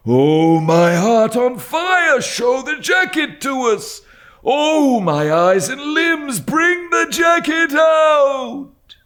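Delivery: high, loud, slow ranting howl; wild old man. A narrator's reading. Mad old dealer demanding to see the jacket, howling. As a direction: howl each phrase like a madman. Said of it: "cried the old man".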